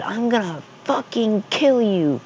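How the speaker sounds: slow, high-pitched imitation of rage; hammed-up high threat without much volume; mock angry threat. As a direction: draw out a kid's threat in a high voice. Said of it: "He was just pissed off".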